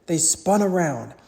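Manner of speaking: plain reading voice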